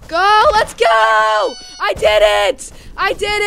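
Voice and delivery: high, loud victory yells; triumphant cheering. Real, not acted. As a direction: scream with joy at the win. Cheering after beating the level.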